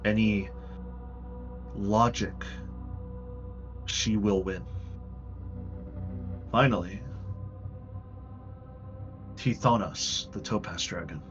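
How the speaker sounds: ordinary talk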